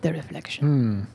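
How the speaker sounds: low, dark, ominous echo; ominous whisper. Shown as a demonstration. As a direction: intone it like a spooky revelation. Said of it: "echoing in a low ominous voice".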